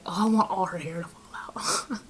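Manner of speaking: mock whisper. Client's hex request quoted.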